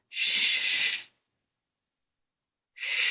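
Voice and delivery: quiet hushing shh; soft shushing. Shown as a demonstration. Telling the inner voices to calm, soft shush. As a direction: hush it like calming a child. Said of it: "you can actually tell the voices".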